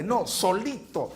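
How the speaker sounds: usual speaking voice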